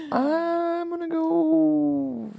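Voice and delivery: very slow, high drawn-out words. Acted. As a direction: stretch each word out. Dragging out the decision.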